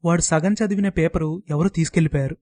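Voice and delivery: ordinary delivery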